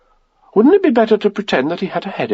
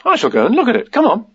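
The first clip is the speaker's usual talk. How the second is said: put-on higher, matter-of-fact boy's voice; lightly voiced Christopher Robin; 7 semitones higher, faster than usual. A narrator's reading. Christopher Robin putting on shoes, decided. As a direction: say it with simple resolve.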